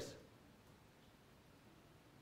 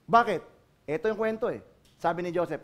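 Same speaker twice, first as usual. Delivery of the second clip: usual speaking voice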